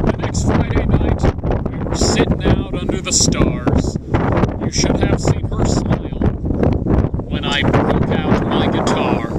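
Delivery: hammy stage actor. Acted. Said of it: "a dramatic reading of".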